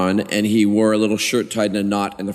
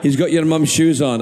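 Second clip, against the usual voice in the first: higher-pitched Scottish dad impression; Scottish accent, pitched up; 5 semitones higher than usual. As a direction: report it as a Scottish father. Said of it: "old school Scottish".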